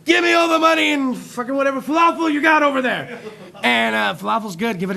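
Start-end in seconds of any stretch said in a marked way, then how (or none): 0.1-3.2 higher, loud robber shout; mock stick-up yell; robber demand. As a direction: imitate a robber barking a demand. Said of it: "the guy goes in, he's like".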